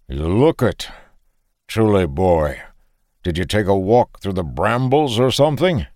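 put-on a Frisian farmer teasing the boy; lightly mocking Frisian farmer; lightly voiced farmer. Farmer teases the scruffy lad, light Frisian accent.

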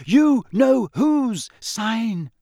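very slow, high, loud wail of dread; frightened moan. A narrator's reading. Hermione pulling Harry as hard as she can, moaning in fear. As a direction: drag out each word in terror. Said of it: "Hermione moaned".

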